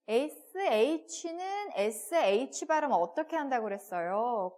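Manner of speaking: normal talking voice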